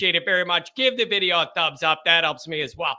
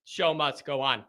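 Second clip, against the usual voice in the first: muttered; 6 semitones lower, 8 dB quieter than usual. Real, not acted. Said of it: "muttered repeat".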